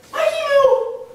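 put-on very high, squealed drunk-friend voice; drunk woman. Drunk friend confessing to the storyteller by her pet nickname, high and giddy. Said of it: "I could tell because she was".

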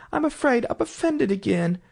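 high-pitched, mild regret; sorrowful. A narrator's reading. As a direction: own up ruefully in a high voice. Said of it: "in a sorrowful tone".

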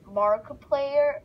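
Markiplier stretched slow and loud; drawn-out shout-out. Acted. Gamer hyping a favourite YouTuber, slow and loud. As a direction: call out Markiplier like an announcer.

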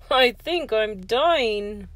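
slow, put-on groan. A narrator's reading. George holds his aching jaw in battle, groaning. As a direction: groan it out in storybook agony. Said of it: "held his jaw and groaned".

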